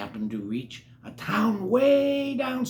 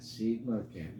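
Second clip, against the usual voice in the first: usual speaking voice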